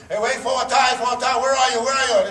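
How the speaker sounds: yelling. Retold or imitated. Stranger on the phone demanding where he is, loud high yell. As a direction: bark it like an impatient boss on the phone. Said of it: "a guy yelling at me in Hebrew".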